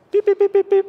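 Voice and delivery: high, loud electronic beeps; imitated beeping. Speaker imitating the electro-fishing boat's beep, high and loud. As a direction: mimic the boat's warning beep. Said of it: "you hear this noise".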